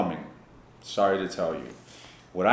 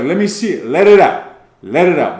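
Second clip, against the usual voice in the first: urging shout; 5 semitones higher, 10 dB louder than usual. Retold or imitated. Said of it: "I was like".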